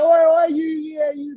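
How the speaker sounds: high-pitched mock jeering; imitated heckle. Someone insults him before his group, high imitated heckle. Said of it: "they just said it like that".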